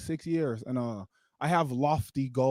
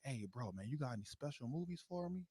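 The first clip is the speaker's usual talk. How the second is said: hushed brother; 2 semitones lower, 17 dB quieter than usual. Retold or imitated. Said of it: "he was like".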